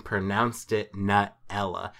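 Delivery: Nutella said as NUT-ella; first vowel of Nutella as in "nut"; nut pronunciation. Retold or imitated. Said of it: "heard someone pronounce it this way".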